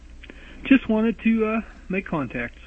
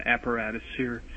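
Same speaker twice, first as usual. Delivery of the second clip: ordinary delivery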